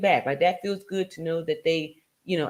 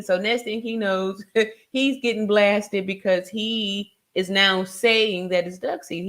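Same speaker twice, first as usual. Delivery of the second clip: ordinary delivery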